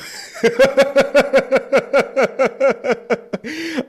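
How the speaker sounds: long, high burst of laughter; big laugh. Real, not acted. Cracking up at the rude magazine line, a long high laugh.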